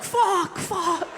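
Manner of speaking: high, frantic double curse; mock panic. Relatives panic over where the ashes went, high frantic cursing. Family can't find the ashes.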